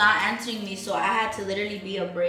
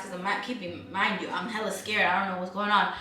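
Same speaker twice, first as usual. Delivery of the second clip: usual speaking voice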